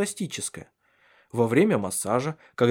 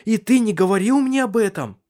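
high, loud, incredulous question; breathless shock; 10 semitones higher, 5 dB louder than usual. A narrator's reading. Woman learning he kept it from her, loud astonishment. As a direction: burst out, stunned and reproachful. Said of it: "У Сесилии перехватило дыхание".

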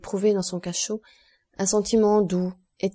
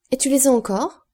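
higher, louder question with an excited rise; eager exclamation; 6 semitones higher, 5 dB louder than usual. A narrator's reading. Count asking the guide if he kept the objects, eager exclamation. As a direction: cry it out, hoping for a yes. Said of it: "s'écria Monte Cristo".

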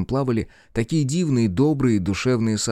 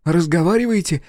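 much higher, astonished question; amazed; 12 semitones higher, 4 dB louder than usual. A narrator's reading. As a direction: gasp it out in disbelief. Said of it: "ещё сильнее удивился мальчик".

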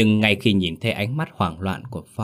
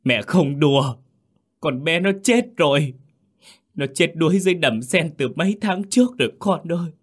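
high anguished wail; gào lên đau đớn; 9 semitones higher than usual. A narrator's reading. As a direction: cry out the terrible news in pain. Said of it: "gào lên trong đau đớn". (gào khóc đau đớn)

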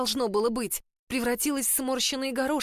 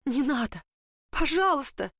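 desperate pleading; 5 semitones higher than usual. A narrator's reading. Emily begs him to stay.

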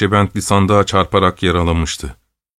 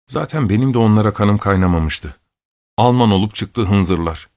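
ordinary delivery

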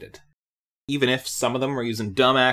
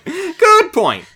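laughing; 14 semitones higher, 7 dB louder than usual. Real, not acted. Second take, laughing high.

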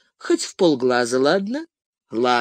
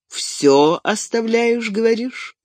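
higher, slow, sceptical probing; squinting, probing question; 7 semitones higher than usual. A narrator's reading. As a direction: draw it out, doubting every word. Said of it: "прищурившись, переспросил Гэндальф".

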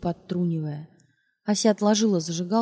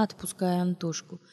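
ordinary delivery, nothing marked

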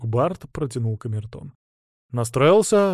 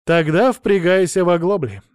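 commanding; 5 semitones higher, 7 dB louder than usual. Acted. Ordering the bard to pull.

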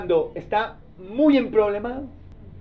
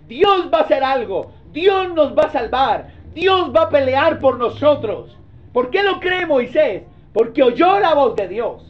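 raised, high-pitched sermon delivery; impassioned preaching; 6 semitones higher, 7 dB louder than usual. Real, not acted. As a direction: preach it with full force, pitch held high. Preaches at full force. (predicación enérgica, en tono alto)

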